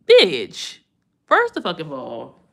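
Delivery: loud, sharp burst of attitude; mock outburst. Telling off the spider, a loud mock outburst.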